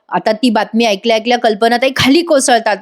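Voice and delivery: usual speaking voice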